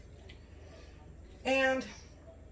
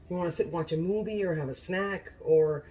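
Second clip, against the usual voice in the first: normal talking voice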